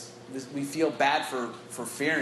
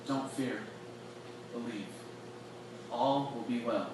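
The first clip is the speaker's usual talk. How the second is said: much softer, close and quiet; tender and intimate; 4 semitones lower, 10 dB quieter than usual. Pretend. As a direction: speak gently straight into his eyes. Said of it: "I can see Jesus like".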